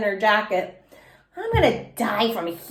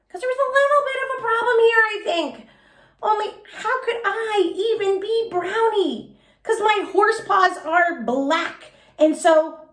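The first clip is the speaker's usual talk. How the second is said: put-on puzzled child; 10 semitones higher than usual. A narrator's reading. Child puzzling over problem.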